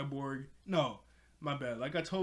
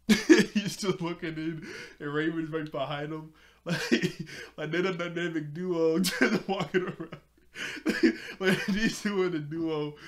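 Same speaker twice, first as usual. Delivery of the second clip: real giggles breaking into loud, higher words; can barely get the words out for laughing; laughing while talking; 3 semitones higher, 9 dB louder than usual. Real, not acted. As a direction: crack up while trying to describe it. Cracking up at the scene.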